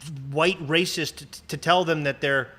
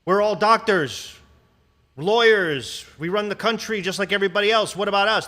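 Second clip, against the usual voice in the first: proud imagined speaker; 4 semitones higher, 5 dB louder than usual. Retold or imitated. Host voicing the message he wants to hear, louder and proud. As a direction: declare it with proud defiance. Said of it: "How about you come up and go".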